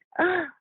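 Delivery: sharp, high intake of breath; a sudden horrified gasp; shocked gasp. Retold or imitated. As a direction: gasp in dismay at the news. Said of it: "And I was like".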